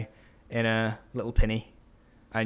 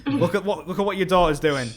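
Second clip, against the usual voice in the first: higher and louder, getting worked up; mock angry captain; 6 semitones higher, 6 dB louder than usual. Captain complaining to Carmen about her daughter, mock angry. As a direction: scold like a furious father showing off the mess. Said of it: "And he's like".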